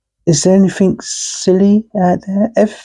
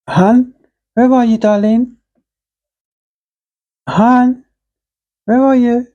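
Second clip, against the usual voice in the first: calling out; 6 semitones higher than usual. Acted. Calling out for partner at home.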